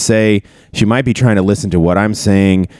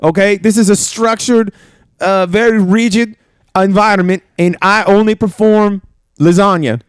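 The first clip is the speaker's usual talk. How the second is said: put-on Italian chef accent; 12 semitones higher than usual. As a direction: play a proud Italian chef in a thick Italian accent. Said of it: "start an Italian accent".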